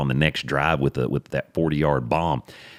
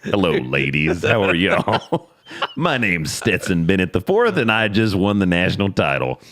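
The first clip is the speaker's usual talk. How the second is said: Stetson Bennett; 5 semitones higher, 4 dB louder than usual. Retold or imitated. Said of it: "You're drunk".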